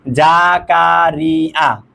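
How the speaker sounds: "Zakaria" as four drawn-out beats; slow ja, ka, ri, a in "Zakaria"; split into syllables. Shown as a demonstration. Teacher working out the answer for students, "Zakaria" syllable by syllable. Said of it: "তাহলে আমরা দেখি এখানে".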